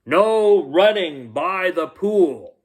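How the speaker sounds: high, slow lifeguard shout; loud call. Acted. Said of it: "a loud voice called".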